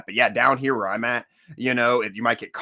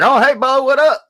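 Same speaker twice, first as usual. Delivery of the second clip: loud excited greeting; 10 dB louder than usual. Retold or imitated. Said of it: "I was like".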